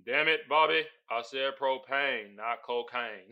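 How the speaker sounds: lightly channel the cartoon dad; a hint of Hank Hill. Host rereading the caption in a light Hank Hill voice. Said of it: "I gotta read it again".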